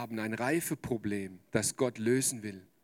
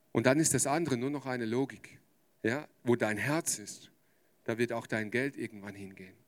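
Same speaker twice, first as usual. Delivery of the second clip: ordinary talk, nothing marked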